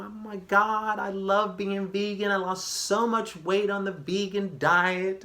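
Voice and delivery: put-on high, slow, gushing girly voice; vegan girl. Mimicking a girl bragging about her vegan weight loss, high and gushing. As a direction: raise the pitch and gush like a vegan convert. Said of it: "Got this girl over here talk about".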